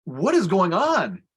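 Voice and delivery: slow, stretched incredulous question, retold; wide-eyed bewilderment. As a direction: imitate a child baffled by a grown-up. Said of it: "Her eyes got huge".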